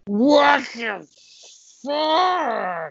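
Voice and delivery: high, drawn-out tearful wail; crybaby cop's stretched-out shock. Acted. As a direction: wail it in disbelief. Crying cop's shocked reaction.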